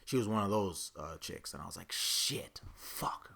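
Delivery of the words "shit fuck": whispered curse. Retold or imitated. Cursing under breath on learning.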